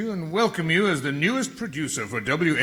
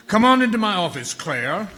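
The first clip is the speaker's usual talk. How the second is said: faster, louder beckoning; louder brisk Southern welcome; Southern boss; 5 semitones higher, 7 dB louder than usual. Acted. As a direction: hurry her in. Maurice beckons.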